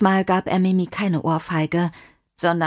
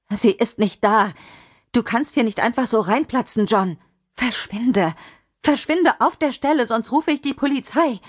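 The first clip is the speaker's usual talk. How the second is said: higher, urgent demand to leave; alarmed angry order; 5 semitones higher than usual. Acted. Mimi ordering intruding John out, alarmed and angry. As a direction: build from firm refusal to high-pitched threat.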